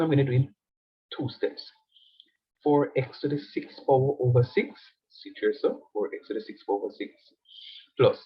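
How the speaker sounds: normal talking voice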